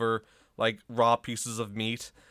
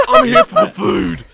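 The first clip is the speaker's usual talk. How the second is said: Gordon Ramsay impression; 15 dB louder than usual. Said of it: "He's like".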